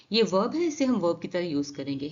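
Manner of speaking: ordinary delivery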